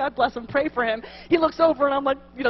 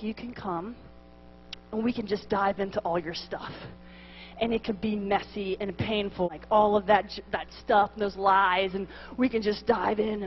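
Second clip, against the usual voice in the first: soft, low voice of a counselling priest; gentle priest's offer; 6 semitones lower, 6 dB quieter than usual. Retold or imitated. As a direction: quietly lay out the offer, low and unforced. Priest gently offers first choice.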